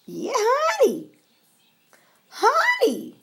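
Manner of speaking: high sing-song call of honey. Real, not acted. Mother calling her child from another room, high sing-song. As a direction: call out to someone in the next room, high and drawn out. Aside calling someone off camera.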